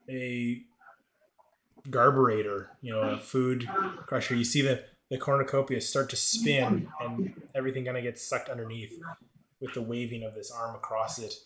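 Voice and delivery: normal talking voice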